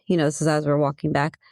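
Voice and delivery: ordinary talk, nothing marked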